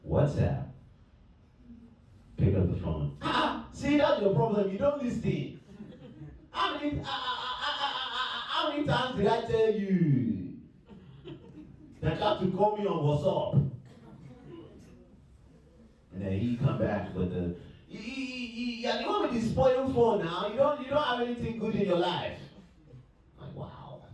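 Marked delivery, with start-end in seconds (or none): 3.2-13.7 high, exasperated African parent voice with laughing ah-ah; African parent accent. Retold or imitated. Friend scolded in African parent accent for not using WhatsApp, exasperated. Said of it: "mimic our parents". 17.9-22.4 put-on high, indignant African-accented comeback; African accent impression. Buddy replying in indignant African parent accent about the phone. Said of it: "It's a good impression".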